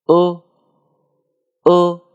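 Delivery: isolated "呃" as a dark L ending; curled-tongue final sound. Shown as a demonstration. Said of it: "最后加一个卷舌的呃". (舌尖抵上齿，短促示范卷舌“呃”)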